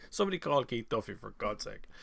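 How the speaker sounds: usual speaking voice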